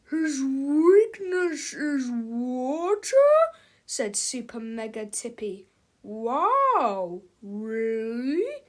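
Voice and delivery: slow, booming giant menace; giant robot villain. Acted. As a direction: speak like a huge menacing machine. Giant Super Mega Tippy voice.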